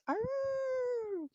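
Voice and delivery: a wolf answering from across the forest; distant wolf howl. A narrator's reading. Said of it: "And he heard off in the distance".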